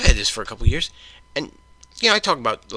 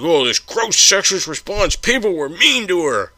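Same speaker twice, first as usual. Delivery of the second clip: slow, higher, whiny parody of Joe; mocking Angry Joe; 6 semitones higher, 5 dB louder, slower than usual. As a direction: exaggerate his outrage to ridicule it. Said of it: "So Angry Joe's like".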